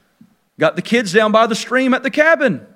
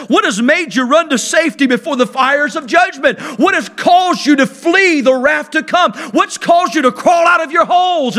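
building pulpit challenge; 6 semitones higher than usual. Acted. Preacher voicing John to the Pharisees, building challenge. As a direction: drive each question harder.

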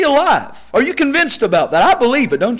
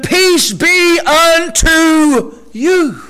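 very slow, raised, drawn-out words; slow solemn blessing; 6 semitones higher, much slower than usual. Acted. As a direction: stretch the blessing over the church.

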